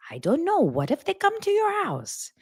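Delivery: high-pitched anxious mom impression; fretting mom. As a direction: imitate a mother imagining the worst, pitched high. Acting out her worried mom.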